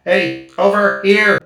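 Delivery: loud, slow droid call. Acted. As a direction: call the birds over, loudly. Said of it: "cried Fez".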